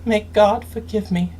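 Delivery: normal talking voice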